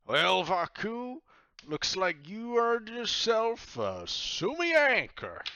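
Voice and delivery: slow, high, loud proclamation; booming, stretched showman delivery; captain announcing a prize. Acted. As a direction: draw it out with big fanfare.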